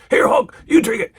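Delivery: high, pushy celebrity impression; Mister T. Mister T handing Hulk a cup, pushy impression. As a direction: press the drink on someone in a mock voice. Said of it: "Mister T is running this skit".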